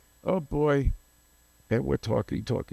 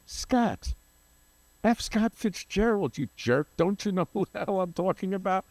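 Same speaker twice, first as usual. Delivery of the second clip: high, scolding impression of an impatient friend; mock-scold him for being clueless, pitched high; exasperated friend; 9 semitones higher than usual. Dennis impatiently scolding him over Fitzgerald, high put-on voice. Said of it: "And he goes".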